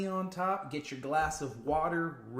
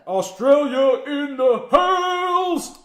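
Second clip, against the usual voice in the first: drawn-out loud hype call, higher and slow; hype shout; 10 semitones higher, 13 dB louder, much slower than usual. Real, not acted. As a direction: belt it out to welcome the Aussie crowd. Hyping up Australian viewers.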